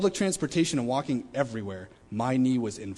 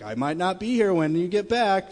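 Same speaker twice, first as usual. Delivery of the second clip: joking grandma; 7 semitones higher, 6 dB louder than usual. Retold or imitated. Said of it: "she always jokes like this".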